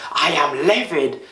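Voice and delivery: high, slow mock upset "I am livid"; hammed-up complaint to be replaced; acting upset. Shown as a demonstration. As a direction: play the strong word big, then drop it. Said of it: "rather than saying".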